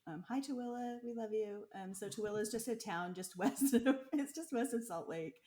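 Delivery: ordinary talk, nothing marked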